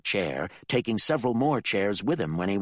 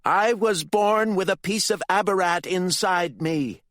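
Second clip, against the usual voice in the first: higher, louder defiant shout; 13 semitones higher, 6 dB louder than usual. Acted. Candy shouts at her father, defiant. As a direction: shout it with everything. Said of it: "Candy shouted".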